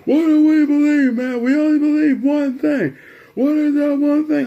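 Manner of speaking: loud, very high imitation of an angry father; yelling dad. Dad yelling at son about what they believe, yelling. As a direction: yell it like Dad losing patience. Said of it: "Then he start yelling at me like".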